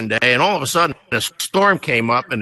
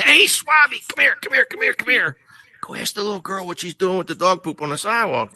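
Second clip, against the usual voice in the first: higher, eager calling out to a buddy; excited calling over; 5 semitones higher than usual. Retold or imitated. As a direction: wave him over, can't wait to share the joke. Marines calling the sailor over.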